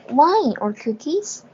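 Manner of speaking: wine or cookies rising at the end like a question; rising tune. Shown as a demonstration. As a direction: lift the voice on cookies. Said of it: "所以要升调".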